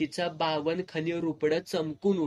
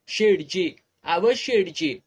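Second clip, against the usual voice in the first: loud call, louder than her earlier plain greeting; calling out to wake him; 7 dB louder than usual. Acted. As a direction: shout him awake from sleep. Kamli wakes the sleeping shopkeeper. (झोपलेल्या शेठजींना मोठ्याने हाक मारून उठवणे)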